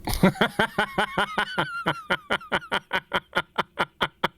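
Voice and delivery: long high giggling cackle in a steady staccato run; high staccato giggle fit; high-pitched laugh. Real, not acted. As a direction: laugh helplessly in high, rhythmic bursts. Laughing at the game on stream.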